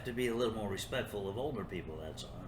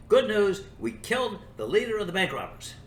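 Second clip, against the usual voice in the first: much louder, higher proclaiming call; triumphant announcement; 10 semitones higher, 8 dB louder than usual. Retold or imitated. Police announcing to the crowd outside in a loud triumphant call. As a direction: boom it out like a proud press release.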